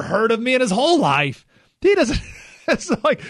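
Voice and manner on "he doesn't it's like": high, laughing through the words. Real, not acted. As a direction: crack up while telling it. Laughing at being fooled.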